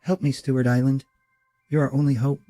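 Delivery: lightly voiced pleading princess, second take; a hint of a hologram plea; Princess Leia. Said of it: "I'm gonna try it again".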